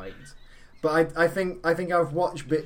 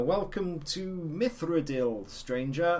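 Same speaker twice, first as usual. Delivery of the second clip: higher, slow sing-out welcome; game NPC greeting; 5 semitones higher, slower than usual. Acted. Retelling an NPC's botched take, high and slow greeting. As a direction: greet the traveller in drawn-out video game dialogue. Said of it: "there's a, an N P C that you can talk to".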